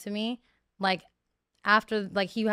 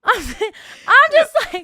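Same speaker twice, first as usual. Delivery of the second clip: squeal of disbelief; 12 semitones higher, 12 dB louder than usual. Real, not acted. Peak of outrage.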